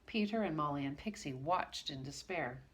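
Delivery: ordinary delivery, nothing marked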